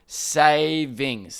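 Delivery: "savings" drawn out in two separate syllables; "savings" stretched, each syllable landed firmly. Shown as a demonstration. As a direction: break "savings" into two long, distinct syllables. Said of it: "He even said every syllable".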